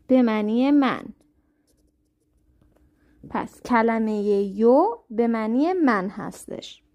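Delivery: ordinary talk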